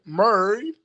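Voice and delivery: mock St. Louis accent, Married said like murr-ee; playful fake Missouri twang on Married; fake Saint Louis accent. Shown as a demonstration. Vlogger to his viewers, saying Married in a mock St. Louis accent. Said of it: "my fake Saint Louis, Missouri accent".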